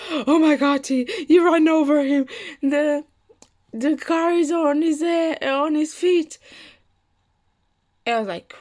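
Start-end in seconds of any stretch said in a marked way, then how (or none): 0.1-6.4 higher, louder retold alarm; alarmed sister. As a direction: exclaim like a shocked sister.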